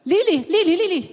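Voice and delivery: calling a name through a door, rising. Retold or imitated. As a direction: employer knocking, calls out to Lily. Employer calls out at door. (employer na tumatawag kay Lily sa pinto)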